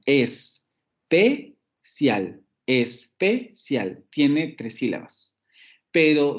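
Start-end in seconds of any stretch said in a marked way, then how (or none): 0.1-4.0 ESPECIAL broken slowly into es, pe, cial with clear gaps; split into syllables. Shown as a demonstration. Teacher showing how Spanish speakers divide especial, slow syllable-by-syllable. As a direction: say especial syllable by syllable, pausing between each. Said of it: "nosotros dividimos esta palabra como".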